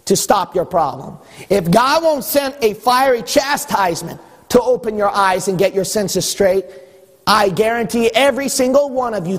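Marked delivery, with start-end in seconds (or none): none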